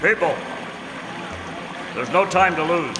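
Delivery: high, loud call to the crowd; rally the townsfolk with urgency; urgent address. Acted. Masked man warning the townsfolk of the flood, loud urgent call.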